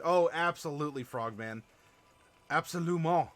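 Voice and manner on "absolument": a light put-on French accent on Absolument; mock French accent. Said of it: "Probably in a more authentic accent".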